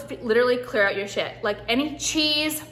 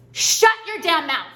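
angry snap; 5 semitones higher, 4 dB louder than usual. Real, not acted. Snapping at imagined nutritionist critic.

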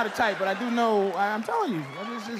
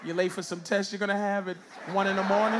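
ordinary talk

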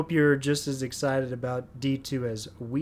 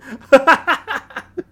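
loud laugh; 10 semitones higher, 8 dB louder than usual. Real, not acted. Big laugh at the segue.

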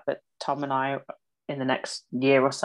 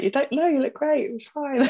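much higher, eager reassuring friend; quoting a friend's upbeat pep talk, pitched far higher; gushing reassurance; 11 semitones higher than usual. Retold or imitated. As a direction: gush praise way up high to cheer her up. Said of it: "you'd say all those ho-, happy things".

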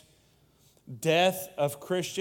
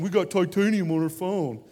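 mock bragging; 3 semitones higher, 6 dB louder than usual. Mocking Apple ad boast.

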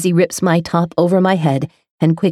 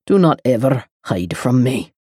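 softened male voice; 4 semitones lower than usual. A narrator's reading. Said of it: "His voice softens".

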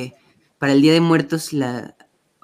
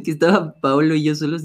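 usual speaking voice

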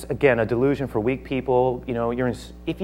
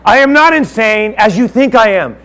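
high and loud, firm insistence; Paul answering Festus forcefully; loud denial; 9 semitones higher, 11 dB louder than usual. Acted. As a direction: declare your sanity to the governor.

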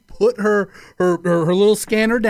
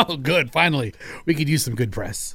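lower, softer, deadpan sarcasm; dry hairdryer industry; 6 semitones lower, 5 dB quieter than usual. Retold or imitated. Hairdryer industry reacting to the news, low and soft. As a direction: mutter it like a relieved company. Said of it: "They're like".

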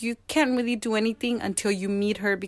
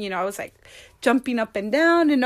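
ordinary delivery

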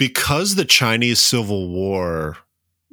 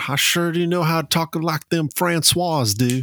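mock Southern accent; 7 semitones higher than usual. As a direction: play a hillbilly bragging about his French in a thick Southern accent. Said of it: "my Southern accent is impeccable".

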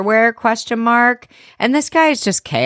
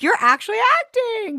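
much higher, mock-astonished praise; impressed squeal. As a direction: squeal it like a proud fan. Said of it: "I was like".

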